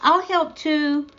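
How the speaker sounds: put-on high, bright boyish offer to help; a cheerful little boy joining in. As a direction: chime in happily like an eager friend. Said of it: "Danny added cheerfully".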